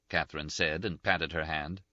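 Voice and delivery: plain reading voice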